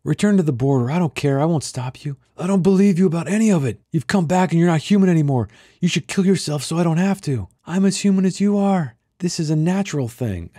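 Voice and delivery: high, loud, pressing voice; hostile surveyor. Acted. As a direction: push the words at her like a command. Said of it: "trying to use the hypnotic suggestion".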